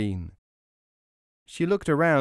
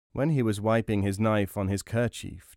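ordinary delivery, nothing marked

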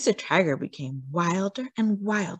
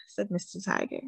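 plain reading voice

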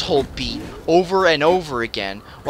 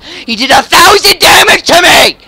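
high, very loud outraged yell; enraged shout; 12 semitones higher, 11 dB louder than usual. Real, not acted. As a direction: scream it in real disbelief. Outraged at huge damage.

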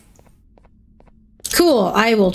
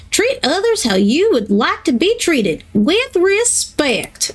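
put-on high, drawling country accent for Sheriff Toad; 7 semitones higher than usual. Player voicing the toy sheriff's sign in a country accent. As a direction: read the sign like a small-town country sheriff. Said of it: "why I gave him a country accent".

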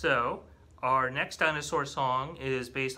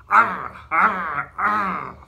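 loud playful dinosaur roars; mock snapping roars; 10 dB louder, much slower than usual. Librarian roaring for the jaw-snapping line, loud and playful. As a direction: snap and roar like a T. rex. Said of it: "snap your jaws".

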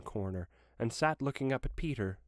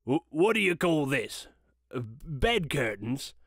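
put-on old Joe asking. A narrator's reading.

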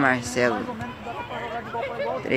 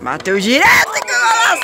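high shouted goal call; explosive goal commentary; 9 dB louder, faster than usual. Real, not acted. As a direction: scream the golaço like a TV narrator. Narrates own goal play-by-play. (narração de gol explosiva)

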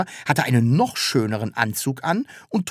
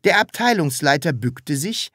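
plain reading voice

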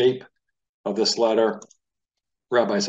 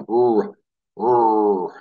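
put-on child's toy-car growl on a front R; rolling toy-car rr sound; car noise R; 5 dB louder than usual. Shown as a demonstration. Teacher imitating a child's toy car, louder growl. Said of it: "a young child playing with a car".

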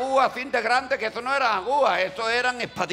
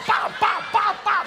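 cracking sounds; 12 semitones higher, 5 dB louder, slower than usual. Retold or imitated. Osteopath cracking his neck.

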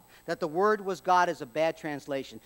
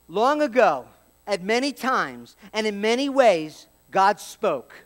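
louder, proclaiming read; scripture reading; 2 semitones higher, 5 dB louder than usual. Acted. Preacher reading Hebrews to congregation, louder and proclaiming. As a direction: proclaim the verse with weight.

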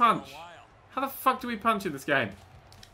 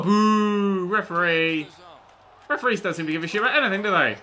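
usual speaking voice

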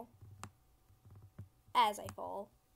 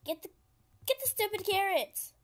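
high, loud exasperation; frustrated outburst; 7 semitones higher, 5 dB louder than usual. Real, not acted. Player to the game over missed carrots, frustrated yell.

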